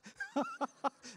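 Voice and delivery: high-pitched burst of laughter. Real, not acted. Preacher amused by the revived man's story, high laugh.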